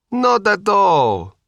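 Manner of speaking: high, slow Sicilian reassurance; a light Sicilian lilt, stretched out; Sicilian accent. A narrator's reading. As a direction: Sicilian restaurateur brushing off a worry. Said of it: "with a thick Sicilian accent".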